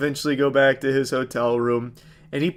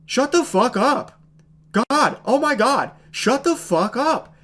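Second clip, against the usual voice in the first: high, drawn-out, half-hearted mock yell; unenthusiastic outburst; 9 semitones higher than usual. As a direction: imitate a badly acted rant, slow and pitched up. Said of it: "in the most unenthusiastic tone ever".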